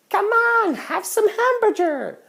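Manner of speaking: high, slow mock Filipino accent host; Filipino accent imitation. Hosts pushing food every five seconds, high Filipino accent. Hosts pressuring him to eat.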